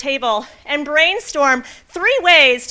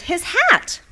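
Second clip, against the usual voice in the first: surprised discovery; 9 semitones higher than usual. Acted. Boy notices the hat.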